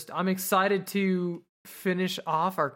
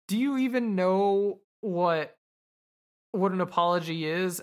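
mocking imitation; 2 semitones higher than usual. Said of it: "imitates him and mocks him".

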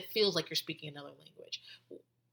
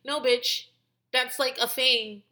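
retold exasperation; 5 semitones higher, 4 dB louder, slower than usual. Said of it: "And I'm like".

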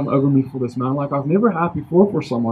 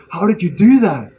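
higher-pitched retold astonishment; freaked-out amazement; 4 semitones higher, 4 dB louder than usual. Healed stranger asks how it happened, freaked-out amazement. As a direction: imitate a man freaking out, pitch jumping up. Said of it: "he freaks out".

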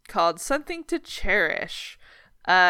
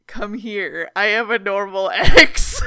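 mock creepy lure; 8 dB louder, slower than usual. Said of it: "those memes that are like".

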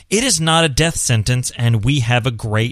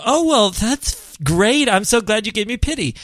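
fake gratitude; 8 semitones higher than usual. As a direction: gush insincerely, pitched well up. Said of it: "I'm not saying you should fake it".

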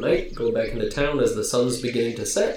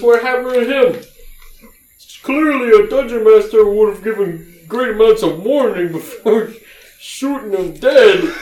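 high, loud oaf voice, slowing with a laugh; sarcastic oaf; 11 semitones higher, 8 dB louder than usual. Acted. GM as Elmo mocks the players' deadly choice, high and loud. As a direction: needle the players about the DM. Elmo jokes about DM warnings.

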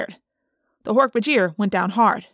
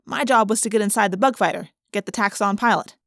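plain reading voice

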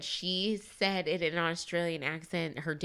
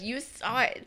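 normal talking voice

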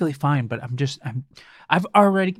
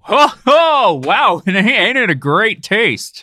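hammy commercial voice; 8 semitones higher, 8 dB louder, slower than usual. Acted. Mock ad voice for Werther's.

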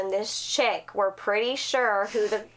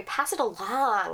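normal talking voice

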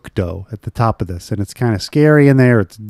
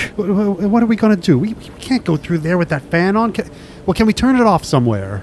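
scared whiny voice; 8 semitones higher than usual. Acted.

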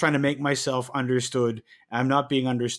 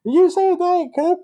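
much higher, louder mock of the worker asking back; confused drive-through worker; 7 dB louder than usual. Mocking the worker who mishears his order, much higher and louder. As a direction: squeak it like a baffled order taker. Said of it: "and they say".

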